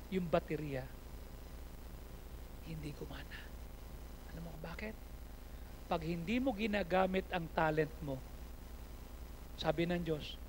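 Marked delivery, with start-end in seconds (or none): 2.6-5.0 whispered aside. Real, not acted. Pastor whispering about his dead Harley battery. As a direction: breathe it out unvoiced. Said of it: "whispered aside".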